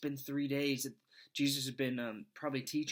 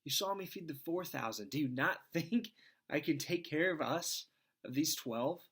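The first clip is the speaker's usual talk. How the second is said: exasperated Jesus; 6 semitones higher than usual. Retold or imitated. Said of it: "It's like".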